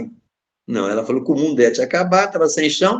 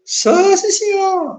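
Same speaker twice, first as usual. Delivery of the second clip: much higher, humble mock mother; 4 dB louder, much slower than usual. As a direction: answer meekly to the police chief. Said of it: "A pessoa tá humilde". (mãe humilde respondendo ao delegado, voz aguda)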